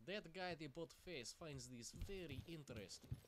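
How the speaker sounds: hammed-up Russian accent, slow. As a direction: talk it through in a jokey Russian accent. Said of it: "Not too bad of a Russian, eh?".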